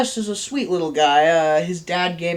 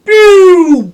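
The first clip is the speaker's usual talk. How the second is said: imitated train whoosh; 13 semitones higher, 15 dB louder than usual. Train hits the boy, sound effect.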